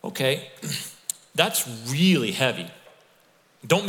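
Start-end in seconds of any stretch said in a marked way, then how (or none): none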